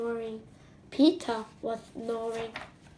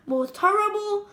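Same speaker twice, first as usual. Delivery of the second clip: ordinary delivery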